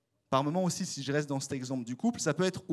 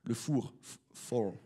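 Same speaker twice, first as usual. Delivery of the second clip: "four" said as an English word, lower and softer, with a French accent; 4 semitones lower, 4 dB quieter, much slower than usual. Shown as a demonstration. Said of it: "désolé pour, euh, mon accent".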